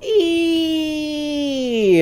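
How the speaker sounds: long high "ee", sliding down at the end; excited leaping "ee"; drawn-out "ee" squeal. Shown as a demonstration. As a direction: sustain a high-pitched "eee". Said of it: "take the W off the front of that and just go".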